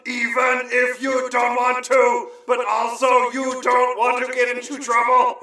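high, sustained industrial-metal chant; hammed-up monotone rock yell.

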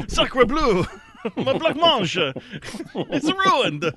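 high, excited French chef, laughing. Acted. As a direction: cry out like a shocked French cook. Mock French reaction to ruined dessert.

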